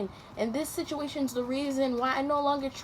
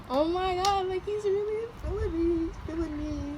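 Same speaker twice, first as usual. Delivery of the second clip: giddy; 8 semitones higher than usual. Retold or imitated. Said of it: "I was like".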